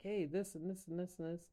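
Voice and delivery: high, fast, rattled-off pointing; mock eager explaining. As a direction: rush through it like showing a sketch. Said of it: "and be like".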